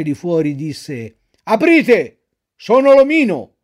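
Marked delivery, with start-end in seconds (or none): 1.4-3.5 loud, commanding shout, slowing at the end; threatening shout. Acted. The omino outside the door, shouting threats to get in.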